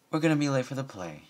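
quick, hurried reminder. A narrator's reading. Bon Bon hurrying Lyra along, fast and urgent. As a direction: rush the words out. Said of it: "she said".

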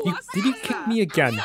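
high, startled protest; startled indignation. Real, not acted. Mom scolding her kicking kid, high and startled. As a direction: squeak it out in disbelief.

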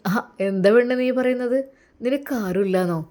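ordinary delivery

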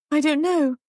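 high, helpless answer; distressed uncertainty. A narrator's reading. Shaken Molly pressed by sergeant.

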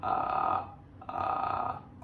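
low creaky edge voice; vocal fry. Shown as a demonstration. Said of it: "これがエッジボイスですね".

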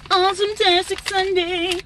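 high sing-song. Shown as a demonstration. Repeats greeting in high sing-song.

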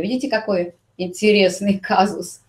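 ordinary talk, nothing marked

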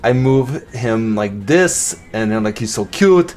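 amateur Austrian-accent impression of an action star; Arnold Schwarzenegger accent. Vlogger redoing a silent unboxing for viewers, mock Austrian accent. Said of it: "in an Arnold Schwarzenegger accent".

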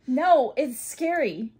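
scared. Real, not acted. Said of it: "Is it burning?".

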